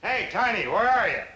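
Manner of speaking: calling loudly across the house. Acted. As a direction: shout for him, high and loud.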